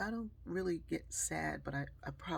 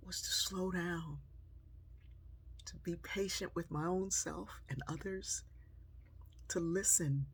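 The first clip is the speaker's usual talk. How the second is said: reflective sermon-like close; 3 semitones higher, 3 dB quieter than usual. Acted. Reflective closing message.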